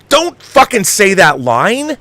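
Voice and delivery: ordinary talk, nothing marked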